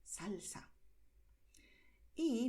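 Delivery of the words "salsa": Salsa whispered breathily; unvoiced Salsa. Shown as a demonstration. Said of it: "baile del Caribe".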